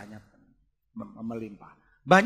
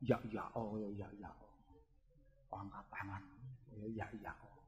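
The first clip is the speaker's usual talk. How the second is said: mocking mumble; 9 semitones lower, 24 dB quieter than usual. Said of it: "kok mburine ngomong".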